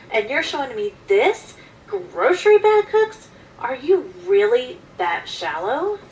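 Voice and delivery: incredulous, high and loud rant; exasperated, rising to a loud pitch; indignant outburst. Acted. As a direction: explode in disbelief, punching the words. Acted outburst at salesman, unsaid.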